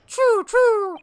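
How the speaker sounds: very high, loud squeaky choo; mouse squeak. Acted. Detective fooling the sailor, very high loud mouse squeak. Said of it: "that was quite unconvincing".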